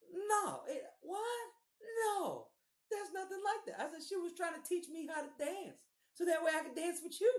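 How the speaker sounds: high-pitched mock pleading; defensive protest. Boyfriend excusing himself to his angry girlfriend, high defensive protest. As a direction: plead innocence in a squeaky high voice.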